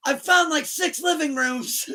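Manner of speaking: high, slow, loud yell; lost in the big house, frantic yell to Hailey. Retold or imitated. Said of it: "running around this big ass house".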